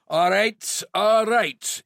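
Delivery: Glaswegian-London shout to the room; booming Glaswegian. A narrator's reading. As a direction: boom it across an office in Glaswegian tinged with London. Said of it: "he boomed across the office in his Glaswegian tinged with London accent".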